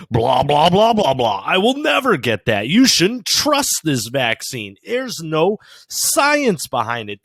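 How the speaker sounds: high, loud, hammed-up mock outrage; mocking the idiots. Ranter imitating vaccine refusers who now push it, mocking outrage. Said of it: "are the same idiots that were like".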